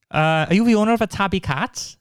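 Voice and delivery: high-pitched Pontypridd sing-song; Welsh accent. Retold or imitated. Neighbour asking about a cat, high Welsh accent. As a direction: imitate the neighbour in a Fireman Sam Welsh accent. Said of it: "that's how he sounded".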